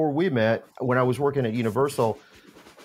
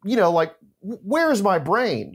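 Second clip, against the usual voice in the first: higher and slow, horrified at himself; mock blind panic, dragging the words; 7 semitones higher, 3 dB louder, slower than usual. The teller recalls realizing who he was chatting with, in mock panic. His panicked inner thought.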